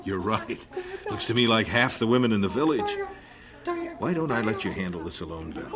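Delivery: chuckling through the words, quick; amused chuckle; laughing. Acted. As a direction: tease her while laughing. Husband laughing at her visitors.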